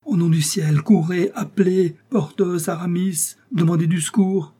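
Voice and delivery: high, urgent cries for help; desperate plea. A narrator's reading. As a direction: send them running, in anguish. Desperate man begs for help. (supplication affolée, voix aiguë)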